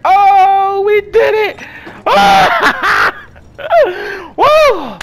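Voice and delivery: high loud whoops of victory; triumphant. Real, not acted. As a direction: celebrate the win. Finished the level.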